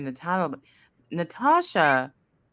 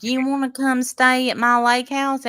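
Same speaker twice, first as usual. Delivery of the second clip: higher-pitched Southern accent impression; mock Southern accent; 7 semitones higher, 4 dB louder than usual. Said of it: "Southern accent".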